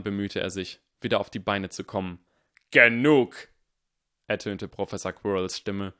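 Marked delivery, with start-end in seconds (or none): 2.7-3.5 much louder sharp command. Acted. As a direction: cut them off like a blade. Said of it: "nun scharf genug". (scharf befehlend)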